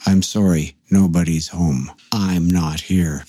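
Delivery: low, deadpan imitation, slowing at the end. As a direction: recite it like an expressionless recorded message. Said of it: "in a deadpan voice".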